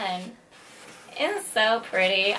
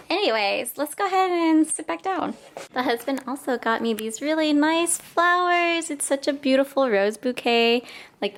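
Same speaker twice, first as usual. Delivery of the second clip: usual speaking voice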